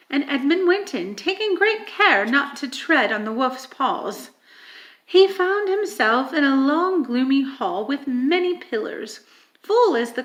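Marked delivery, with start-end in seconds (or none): none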